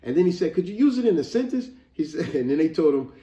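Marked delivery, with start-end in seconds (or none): 0.5-1.7 put-on high, louder anxious kid's question; imitated nervous boy. Retelling the boy asking the judges for help, nervous. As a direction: plead lightly for a clue, high-pitched. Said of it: "he was so nervous to spell it".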